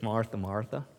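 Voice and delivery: soft, low, tender calling of the name; gentle rebuke. Acted. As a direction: call her name gently, like a patient teacher. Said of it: "And Jesus said".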